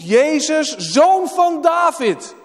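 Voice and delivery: high, loud, slow proclaiming call. Acted. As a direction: stretch the title out like a shout across the street. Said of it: "Hij roept".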